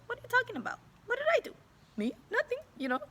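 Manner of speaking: put-on very high, wide-eyed innocent boy; feigned innocence. A narrator's reading. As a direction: play dumb, all innocence.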